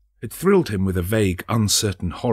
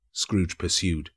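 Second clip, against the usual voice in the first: plain reading voice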